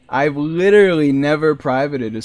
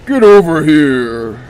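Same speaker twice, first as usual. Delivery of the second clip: loud, quicker growled yell; Scorpion voice; 9 dB louder, slower than usual. Shown as a demonstration. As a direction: shout it like the fighter's catchphrase. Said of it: "do the Scorpion voice better".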